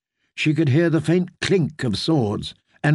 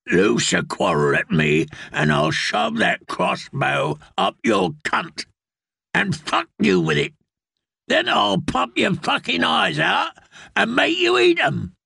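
menacing villain voice, rising higher as it goes; furious threat; 6 semitones higher than usual. A narrator's reading. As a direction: snarl the threat, climbing into rage. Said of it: "The fury in the man's voice".

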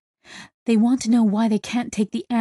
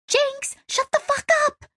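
very high-pitched angry cry; exasperated outburst. A narrator's reading. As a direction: cut them off with a high, frantic scolding. Kizzy snaps at ranting Jenks.

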